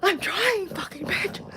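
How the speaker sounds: high-pitched, loud sobbing protest; hammed-up tearful wail; acted blubbering. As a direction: blubber it like a child who can't stop. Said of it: "remember crying like that when a ki".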